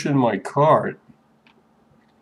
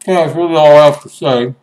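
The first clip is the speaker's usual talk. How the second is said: mouth full; 4 semitones higher, 9 dB louder, faster than usual. Real, not acted. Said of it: "I'm talking with my mouth full of food".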